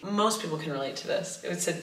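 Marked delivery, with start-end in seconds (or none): none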